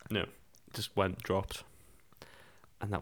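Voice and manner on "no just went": usual speaking voice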